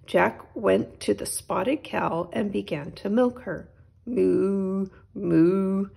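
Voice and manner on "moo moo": cow mooing. Acted. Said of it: "said the spotted cow".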